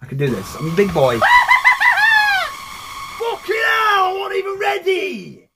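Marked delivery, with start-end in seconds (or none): 1.2-3.1 long, loud high scream; startled shriek. Real, not acted. Jump scare hits. 3.2-5.5 very high screamed protest; panicked shriek. Real, not acted. Caught unready by scare.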